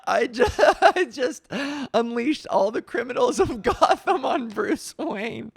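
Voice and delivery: loud, high wail breaking into laughter; mock-panicked laughter. Real, not acted. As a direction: crack up in horror at your own slip. Blurts a secret, cracks up.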